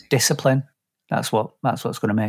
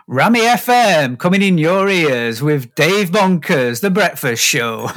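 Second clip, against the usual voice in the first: slow, drawn-out radio station ident; high start, stretched jingle delivery; breakfast-show DJ; 5 semitones higher, 3 dB louder, slower than usual. Acted. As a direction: announce the show like a cheesy local DJ. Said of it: "And the accent you use is brilliant".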